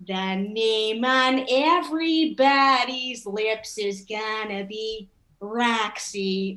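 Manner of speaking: put-on slow, heavy Chicago accent; unsexy Chicago accent on the song line. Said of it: "done with a Chicago accent".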